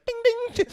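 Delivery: high sing-out imitating an alert; phone notification chime. Imitating a betting notification on your phone, chiming. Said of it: "notification on your phone that's like".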